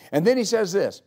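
ordinary talk

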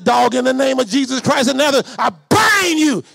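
bind the dog in Jesus' name; commanding shout. Retold or imitated. Prophet retelling how he rebuked a dog, loud shout.

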